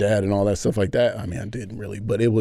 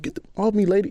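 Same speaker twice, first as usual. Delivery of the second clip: angry teary snap; 10 semitones higher, faster than usual. Retold or imitated. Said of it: "but that angry cry".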